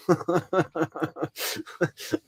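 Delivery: high, rapid giggling; a run of high-pitched laughter; laughing. Real, not acted. Host tickled by the lawyer's delivery, high laughing.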